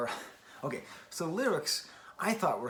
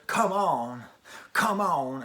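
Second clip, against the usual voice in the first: louder, slow rising come on, imitating the singer; imitating her hype; 3 semitones higher, 7 dB louder, much slower than usual. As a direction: pump up the crowd like a frontman, mock-style. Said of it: "you get that sense when she says".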